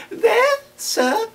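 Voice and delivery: high, timid little voice; timid child. A narrator's reading. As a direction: explain shyly, voice up high. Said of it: "I timidly explained".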